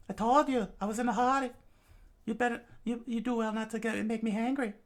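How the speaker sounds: high, cool annoyed warning; high and menacing. Acted. Robin warns.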